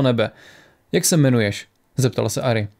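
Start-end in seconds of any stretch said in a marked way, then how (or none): none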